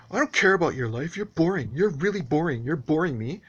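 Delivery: imitating the neighbor's scornful brush-off; dismissive neighbor. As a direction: wave him off as boring. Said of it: "and he goes".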